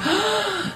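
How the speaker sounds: high gasp of dread, acted out; mock startled gasp. Former pupil spotting teacher at the station, high mock gasp. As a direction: gasp as if caught.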